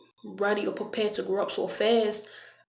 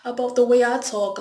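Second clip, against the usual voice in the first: retold incredulous reply; 5 semitones higher, 4 dB louder than usual. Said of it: "I was like".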